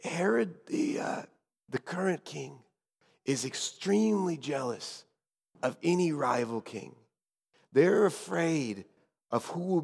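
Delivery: very soft, halting undertone; hushed tones. Acted. Guide warning Azariah about the jealous king, hushed. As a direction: confide it softly, pausing between phrases. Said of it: "I whispered in hushed tones".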